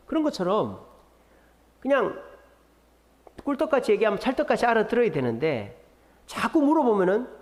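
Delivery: normal talking voice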